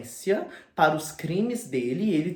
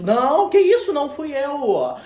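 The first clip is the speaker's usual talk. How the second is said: much higher, louder, slow desperate protest; panicked denial; 11 semitones higher, 11 dB louder than usual. Retold or imitated. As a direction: plead your innocence in a high, loud panic. Dorian panicking at accuser.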